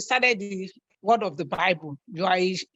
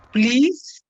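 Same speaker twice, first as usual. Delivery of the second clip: exasperated plea; 7 dB louder than usual. Real, not acted.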